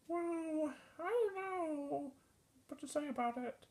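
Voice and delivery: high, very soft little voice; reply timidly high and hushed as a ghost; ghost character. Acted. Puppet ghost character replies.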